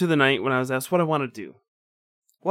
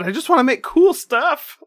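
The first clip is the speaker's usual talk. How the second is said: excited; 11 semitones higher, 7 dB louder than usual. Retold or imitated. Retold excited reply to artist.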